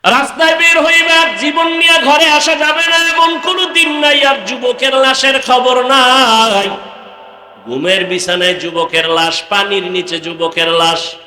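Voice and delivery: high wailing sermon chant, settling onto a level note; high chanted warning, easing down; full-force preaching. Acted.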